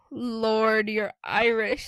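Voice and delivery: loud, startled exclamation; surprised. A narrator's reading. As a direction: blurt it out, amazed.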